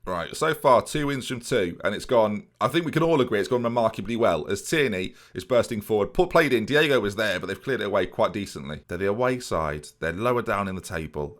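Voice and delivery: ordinary delivery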